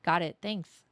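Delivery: ordinary talk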